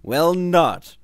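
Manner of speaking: high, loud petulant whine. A narrator's reading. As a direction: answer like a sulky child. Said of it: "Hans answered petulantly".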